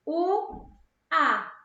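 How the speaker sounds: "o" and "a" said apart, a clear gap between; isolated vowels "o" and "a"; separated vowels. Shown as a demonstration. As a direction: sound out "o", then "a", as two separate syllables.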